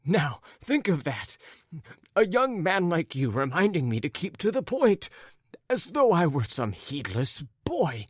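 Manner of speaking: high, animated character voice full of fuss; flustered raccoon-coated gentleman. Acted. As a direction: play a fretful, wounded gentleman.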